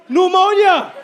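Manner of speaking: high, loud mock cry of doom; imitating a fearmongering mother; alarmist mom. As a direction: shout the diagnosis as a dire warning. Mom's fearmongering acted out.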